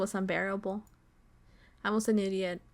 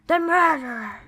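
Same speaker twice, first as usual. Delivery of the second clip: loud, higher mock-horror cry; dramatic exclamation; 6 semitones higher, 8 dB louder than usual. Player repeating the story's reveal of the murderer, dramatic exclamation. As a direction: blurt it out big and melodramatic.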